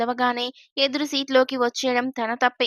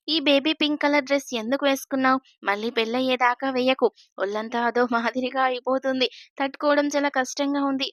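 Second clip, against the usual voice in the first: usual speaking voice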